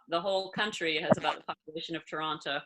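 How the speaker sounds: ordinary talk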